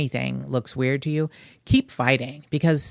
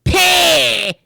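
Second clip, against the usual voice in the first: "Pay!" yelled much higher and louder in a fake Southern twang; mock Southern shout; 12 semitones higher, 8 dB louder than usual. Host mocking the film's accent to co-host, shouted mock twang "Pay!". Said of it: "It sounds like Eric Cartman".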